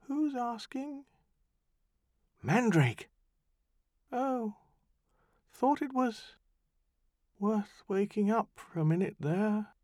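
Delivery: small, far-off, weary demon; a faint little voice from afar; tiny distant voice. Acted. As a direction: sound faint and far away, slow. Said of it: "A tiny voice, as if from far away".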